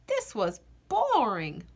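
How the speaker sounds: usual speaking voice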